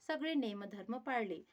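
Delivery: plain reading voice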